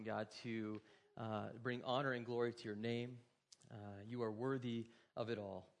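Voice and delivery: soft, low, reverent prayer voice. Acted. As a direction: pastor praying over the congregation, hushed. Opening prayer before the sermon.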